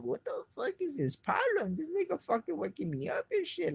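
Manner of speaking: high-pitched indignant rant; retold outrage. Retelling outrage at being woken.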